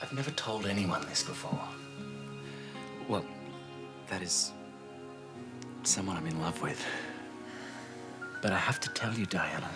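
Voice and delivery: confiding troubles. Acted. Nervous love confession in secret.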